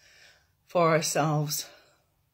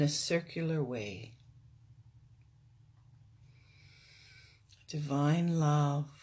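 guided meditation; 3 dB quieter than usual. Real, not acted. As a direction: guide them into stillness, unhurried.

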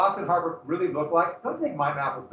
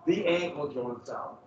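slow ominous relish; 3 semitones lower, slower than usual. Acted. Lingering on slow torture.

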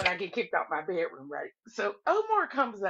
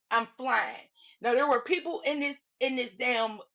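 ordinary talk

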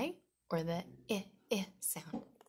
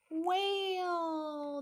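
excited gasp; 11 semitones higher than usual. Acted. Gasping reveal of the whale.

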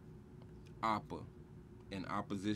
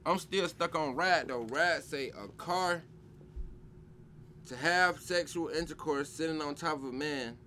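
incredulous reread; 6 semitones higher, 5 dB louder than usual. Real, not acted.

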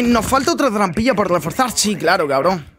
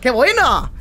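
shouting orders over battle; 8 semitones higher, much slower than usual. Real, not acted. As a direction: yell it out, hyped after the win. Team wins round, cheering loud.

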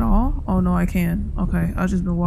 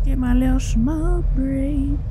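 silly drawl; 4 semitones higher, much slower than usual. Pretend.

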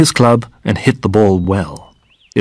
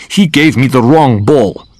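higher, mildly angry accusation; angrily; 4 semitones higher, 4 dB louder than usual. A narrator's reading. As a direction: insist crossly that you were wronged. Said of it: "began Goldfinger angrily".

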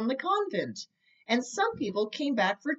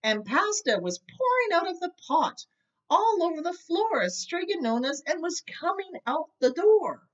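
mounting excitement; 7 semitones higher than usual. A narrator's reading. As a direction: let the panic swell as it pours out. Pasta flooding the house.

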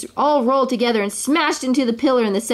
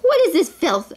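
high-pitched acted disgust; indignant, revolted storybook voice; 7 semitones higher than usual. A narrator's reading. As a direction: ask it as an appalled king. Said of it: "asked the disgusted king".

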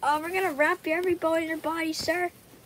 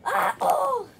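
falling yelp; 6 semitones higher, 6 dB louder than usual. Pretend. Character falls from height.

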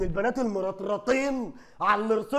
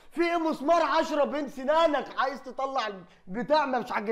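ordinary talk

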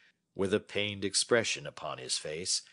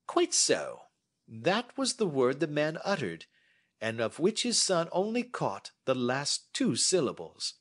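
a touch higher, measured and assured; lightly voiced Holmes; 10 semitones higher, 5 dB louder than usual. Holmes inviting Watson to sit and listen, lightly voiced. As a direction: hold forth like a confident detective.

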